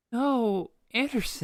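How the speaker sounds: high pained cry of dismay at a rival's pick; anguished groan over a stolen pick; high dismayed wail. Real, not acted.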